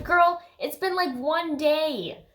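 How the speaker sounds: high, drawn-out incredulous scolding; exasperated, high-pitched complaint; mock exasperation. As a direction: stretch it out slowly in disbelief.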